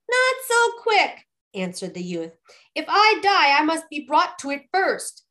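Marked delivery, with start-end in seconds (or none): 0.1-1.3 very high, slow retort; defiant youth. A narrator's reading. Youth answering the death threat, defiantly. Youth defies the old man. 2.7-5.2 high, loud retort; defiant youth. A narrator's reading. Youth refusing to die just yet, defiantly. Youth defies the old man.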